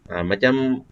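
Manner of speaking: ordinary talk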